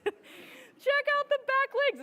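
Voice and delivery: high, slow delighted gush over the video. Real, not acted. Photographer gushing to audience over her pika video. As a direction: squeal with delight at the back legs.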